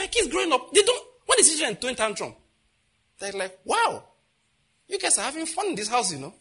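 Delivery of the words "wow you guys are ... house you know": much higher, teasing marvel with a laugh; mock amazement. His children marvelling at other kids' tantrums, high teasing wonder. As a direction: play the kids gawking at a spectacle.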